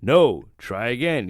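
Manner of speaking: slow, loud, low toy voice; talking spelling toy. Retold or imitated. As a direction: announce it like a learning computer. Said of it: "hits enter and it goes".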